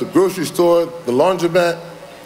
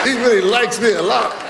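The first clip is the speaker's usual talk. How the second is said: high, laughing through the words; chuckling as he jokes; 7 semitones higher than usual. Real, not acted. Laughing about Vince hollering at him.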